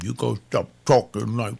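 put-on planned stern talking-to for the young man; stern advice. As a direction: tell him straight, no nonsense. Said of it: "I gotta tell him".

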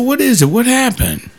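high, gentle questioning; concerned pastor. Retold or imitated. Pastor retelling how he asked a crying church member what happened, high and concerned. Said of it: "And I said".

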